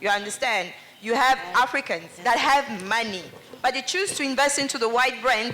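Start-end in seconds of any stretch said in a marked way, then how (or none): none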